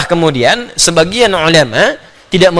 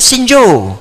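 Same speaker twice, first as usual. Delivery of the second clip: Libyan Arabic greeting, a touch higher; Libyan dialect greeting; 5 semitones higher than usual. Shown as a demonstration. Teacher mimics how Libyans greet, in light Libyan Arabic. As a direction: lightly put on the Libyan street word. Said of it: "Datang ke Libya".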